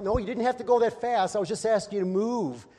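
lightly played exasperation at a speeding driver; exasperated driver. Preacher scolding a driver who floors it, mock exasperated. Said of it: "and I'm like".

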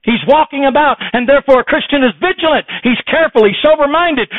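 high, loud, driving preacher's cry; preaching at full force. Acted. Preacher warning the congregation of Satan, loud and high. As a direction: hammer the warning home to the church.

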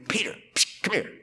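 whispered, soft call to Peter; psst, come here, under the breath; hushed aside. Acted. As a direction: pull him aside in a whisper. Jesus pulls Peter aside privately.

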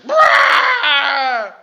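high, loud rattling brrr; cartoon steam blowing off the head; cartoon boiling-over noise. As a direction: blow your top like a cartoon gauge. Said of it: "temperature gauge blows off the head".

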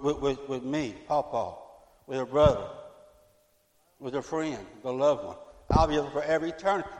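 ordinary talk, nothing marked

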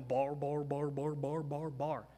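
lightly voiced, low babbling of nonsense syllables; mock barbarian babble. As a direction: mimic foreigners babbling, only a hint of a voice. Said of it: "all they do is they walk around going".

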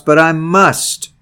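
"must" slow and set hard against "may"; stress on must. Shown as a demonstration. Preacher contrasts may with must, stressing must. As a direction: drag out "I must" as a firm answer to "may". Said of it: "It was not, "Come down ... because I may".

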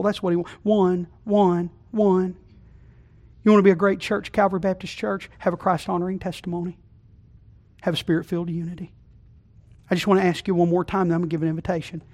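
usual speaking voice

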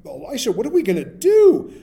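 loud, high panicked cry to the boss. Retold or imitated. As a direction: call out to him in alarm, high and loud. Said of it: "he cries out to his boss".

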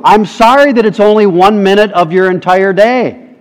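ordinary delivery

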